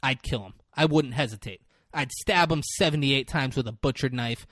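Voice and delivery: building angry tirade. Acted. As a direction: build from controlled menace to loud oath. Retold lawyer's violent, building tirade.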